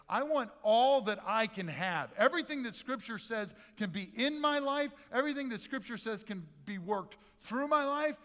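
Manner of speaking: loud, high, fervent pleading; full-voiced, high-pitched fervour; impassioned plea. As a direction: plead eagerly with God, building big. Imagined eager plea to God.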